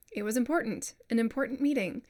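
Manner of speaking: "important" with the T swallowed into a glottal stop. Shown as a demonstration. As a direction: say "important" naturally, T bypassed. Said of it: "we bypass those T's".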